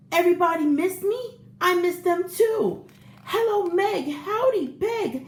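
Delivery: beam at every egg by name; excited greetings. Acted. Egg greets each carton friend.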